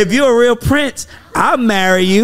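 a hint of a swooning girl; high mock girl voice. Man mocking a girl who wants a prince, high and light. Repeating girl quote higher.

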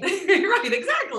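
high, laughing agreement. Real, not acted. Guest agreeing with the co-host's glowing joke, laughing high.

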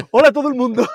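imitando el saludo. Retold or imitated. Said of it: "cómo es lo que dices tú".

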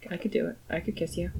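very soft, shy Juliet; coy murmur. Acted. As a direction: play a hushed, coy consent. Juliet shyly agrees to a kiss.

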